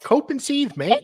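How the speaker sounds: very high Aussie attempt that sounds Irish; bad Australian accent. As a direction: fake Australian sliding into Irish. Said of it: "everything sounds like an Irish accent that you do".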